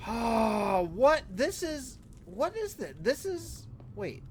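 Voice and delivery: lost for words at what he sees; stunned disbelief. Real, not acted.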